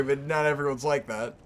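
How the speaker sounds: ordinary talk, nothing marked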